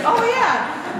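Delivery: sudden mock realization from the old lady; a hint of a surprised grandma; realizing grandma.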